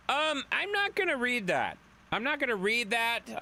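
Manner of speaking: squeaky high I'm not gonna read that; high laughing refusal. Real, not acted. Streamer refusing to read a rude chat message, squeaky.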